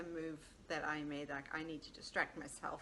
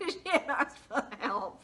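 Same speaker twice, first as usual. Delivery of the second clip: laughing; 6 semitones higher, 10 dB louder than usual. Real, not acted. Laughing while stressing a point.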